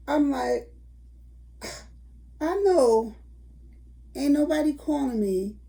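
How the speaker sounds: normal talking voice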